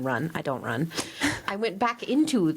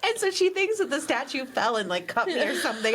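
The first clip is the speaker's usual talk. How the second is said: ordinary talk, nothing marked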